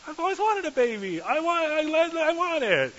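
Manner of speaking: hammed-up girlish squeal of delight; princess voice. Preacher mimics Pharaoh's daughter finding baby Moses, high excited squeal. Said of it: "She goes".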